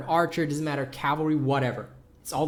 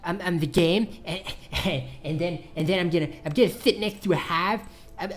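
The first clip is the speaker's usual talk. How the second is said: mocking, stammering boastful gamer voice; Crazy Jokers impression. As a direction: stumble and repeat words like a dim braggart. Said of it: "that's what Crazy Jokers sounds like".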